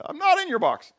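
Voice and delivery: God bursting out of the box, teasing high voice; cheeky defiance. Pretend. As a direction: pop out gleefully and taunt. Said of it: "jump out of your box and say".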